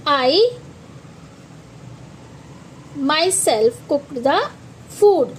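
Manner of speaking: "I myself cooked the food" read very slowly, leaning on myself; slow dictation stress. Shown as a demonstration. Teacher dictating the example sentence slowly. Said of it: "myself ने किस पर जोर दिया".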